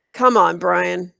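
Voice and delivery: loud, slow drawn-out coaxing; goading. A narrator's reading. Boy goading his friend in front of the class. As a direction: egg him on.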